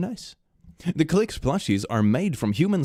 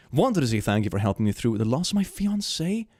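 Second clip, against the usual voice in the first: usual speaking voice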